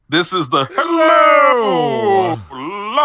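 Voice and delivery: loud, high, drawn-out sing-song greeting. Retold or imitated. Podcaster demonstrating the belly button voice to friends, loud sing-song. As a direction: belt out the silly belly-button voice. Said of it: "it's titled The Voice".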